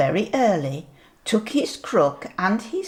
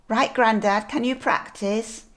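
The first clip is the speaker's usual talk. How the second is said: plain reading voice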